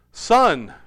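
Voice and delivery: loud, firm father calling his son; fatherly order. A narrator's reading. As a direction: lay down the law as a father. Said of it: "ordered a father".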